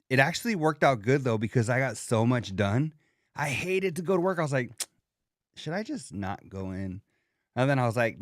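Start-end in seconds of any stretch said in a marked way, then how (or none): 5.6-7.0 low, soft musing to yourself; mock hesitation, dropped low and quiet; reluctant thought. Quoting his own reluctant thought.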